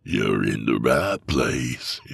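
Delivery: put-on low, slow old hermit drawl; menacing old man. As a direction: drawl it like a creepy old backwoodsman. Old man voiced in story.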